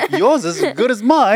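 put-on high British accent through laughter; laughing, bad British accent; attempted British accent. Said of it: "like, British accents, or we pick an accent".

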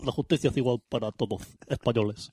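very low, soft royal address; hammed-up monarch addressing the nation; mock king. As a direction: drop deep and quiet for a mock royal speech. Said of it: "Aquí tenemos a su majestad el rey".

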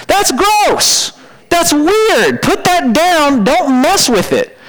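high, drawn-out, appalled protest; disgusted warning. Acted. Preacher scolding someone playing with a dead squirrel, disgusted warning. Acted reaction to playing with roadkill.

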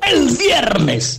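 high, drawn-out indignant repeat; incredulous echo. Retold or imitated. Pepe hammering the date back at the caller, incredulous. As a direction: stretch it out in mock outrage.

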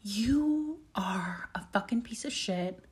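whispered self-insult. Retold or imitated. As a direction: hiss the insult at yourself, slowly. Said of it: "I was like".

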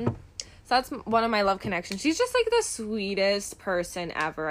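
ordinary delivery

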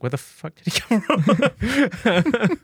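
high, slow incredulous crowd voice; baffled onlookers, half laughing; mock astonishment. Game master voices stunned crowd.

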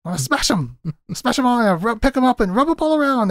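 high, put-on childish glee; gleeful squeal of a voice, hammed up; giddy, childlike. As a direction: imitate a kid delighting in destruction. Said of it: "he's so giddy and childlike".

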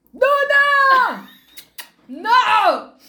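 real high, drawn-out shout; loud, high scolding yell; yelling at the dog. Real, not acted. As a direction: holler to stop a misbehaving dog. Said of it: "le grité a mi perro".

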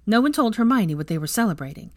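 ordinary delivery

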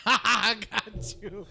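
high, loud, drawn-out laughing taunt; laughing gloat. Real, not acted. Player gloating over catching an opponent, laughing. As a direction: crow it through laughter.

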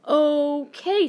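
high, loud drawn-out okay; really thrown, pitched right up; taken aback. Real, not acted. Vlogger answers uninvited voice assistant, taken aback. Reacts to assistant triggering by accident.

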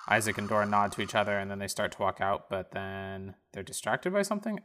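usual speaking voice

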